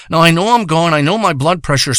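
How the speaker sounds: ordinary talk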